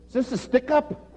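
high, startled question; a nervous guy blurting it out; alarmed customer. Retold or imitated. Man walking in on the kneeling group, high startled question. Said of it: "that quick he said".

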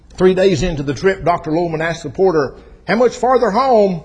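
loud, high preacher's storytelling; full-force preaching. Acted. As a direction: belt it out to the congregation.

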